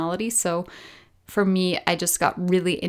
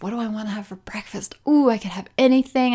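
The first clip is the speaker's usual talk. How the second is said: whispered thought; 2 semitones higher, faster than usual. Retold or imitated. Thinking about breakfast in a quick excited whisper. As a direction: whisper to yourself, tempted by options. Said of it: "and thought".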